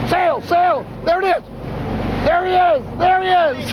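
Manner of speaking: high excited yelling at the sighting; excited shout. Real, not acted. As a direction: shout it out as the fish breaks the surface. Spotting a marlin at sea.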